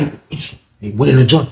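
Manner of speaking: usual speaking voice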